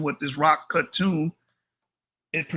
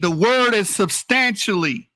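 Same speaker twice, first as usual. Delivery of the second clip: ordinary delivery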